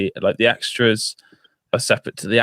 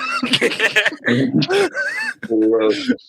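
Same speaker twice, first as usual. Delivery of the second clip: high burst of real laughter; laughing. Real, not acted. Laughing at the sun joke.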